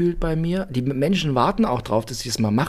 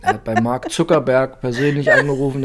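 normal talking voice

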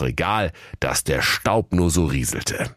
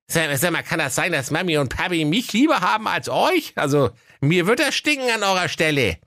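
put-on high cheeky boy voice; bratty teenage boy, much higher; cheeky young lad; 12 semitones higher than usual.

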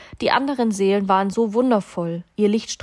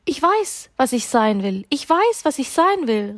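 high, eager little soul calling out; excited call; 6 semitones higher, 3 dB louder than usual. A narrator's reading. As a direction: call it out excitedly, pitch leaping up. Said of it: "rief die kleine Seele aufgeregt". (aufgeregt gerufen)

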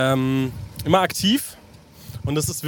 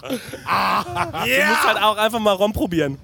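laughing; 5 semitones higher, slower than usual. Real, not acted. Joke laughed through.

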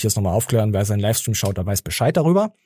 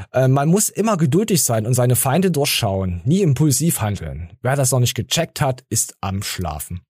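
normal talking voice

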